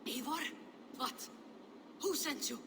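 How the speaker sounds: panicked questions. Acted. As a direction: fire the questions at a possible enemy. Frightened woman suspects an attacker.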